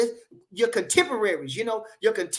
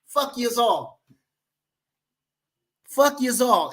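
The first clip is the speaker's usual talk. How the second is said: put-on Italian accent; 3 semitones higher, slower than usual. Streamer cursing all his critics, mock Italian accent. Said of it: "in my Italian voice".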